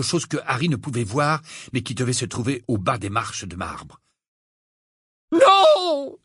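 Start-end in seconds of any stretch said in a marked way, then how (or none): 5.3-6.2 very high, loud scream. A narrator's reading. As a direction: scream it in terror. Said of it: "hurla-t-elle".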